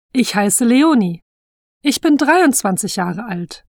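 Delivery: ordinary talk, nothing marked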